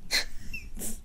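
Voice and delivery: a high, airless, silent-shaking laugh; high breathless giggle. Real, not acted. Laughing at her own fan fiction habit, high breathless giggle. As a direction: giggle helplessly, almost without sound.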